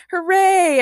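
high, loud hooray; cheered. A narrator's reading. Everyone celebrating the exploding robot, cheering. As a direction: cheer it with a long lifted end. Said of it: "everyone cheered".

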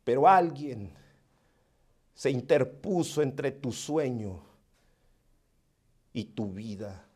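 soft, low, intimate preaching; hushed sermon. Acted. Preacher comforting the grieving listener, softly. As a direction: lean in and speak softly to the hurting.

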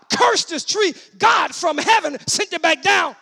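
high, loud, driving sermon shout; full-force preaching. Real, not acted. Preacher tells congregation what Jesus meant about faith, full-force preaching. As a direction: preach it at the top of your voice.

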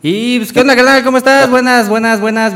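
high, loud radio welcome; excited host greeting. Real, not acted. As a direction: greet the guest with big energy. Hosts greeting the guest. (saludo efusivo de locutor)

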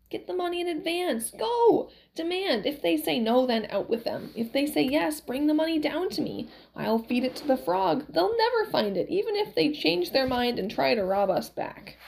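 put-on high, swooping old-woman voice; insistent, high-pitched scheming; Valerie, bossy old wife, urging. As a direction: order the husband about in a high voice.

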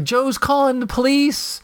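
high, urgent announcement; panicked wife. Retold or imitated. Wife tells neighbors about the police call, high alarm. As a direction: alarm in every word. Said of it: "it's like".

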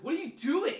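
high, loud exasperated question; sharp rise in pitch and volume, imitating a telling-off; retold yelling. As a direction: yell it as an appalled parent. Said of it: "yelling at me".

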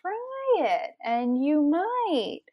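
a mildly voiced, coaxing Sam, going up high and the last phrase slowed; lightly acted pleading Sam, reading to a child; Sam from the book. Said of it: "Sam is like".

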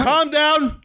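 sudden high-pitched "Calm down". Acted. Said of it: "Was that necessary?".

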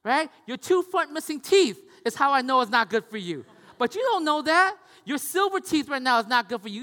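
loud, high mock scolding; a high, loud teasing scold; teasing rant. Real, not acted. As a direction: rib him loudly about his teeth. Teasing son about his teeth.

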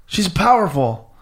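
high, loud cry of awe; astonished demon kings. Retold or imitated. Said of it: "and they're like".

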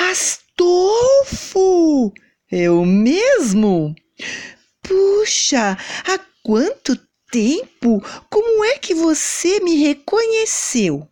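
high, slow delighted surprise; a touch of tearful joy; surprised. A narrator's reading. Mother recognizes old neighbor.

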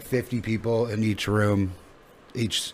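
ordinary talk, nothing marked

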